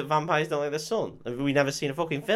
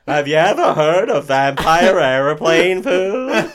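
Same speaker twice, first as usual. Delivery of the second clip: loud, drawn-out stand-up setup; hammed-up stand-up comic; 5 semitones higher, 12 dB louder, slower than usual. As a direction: belt it out like a hack comic. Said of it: "Transylvania open mic night".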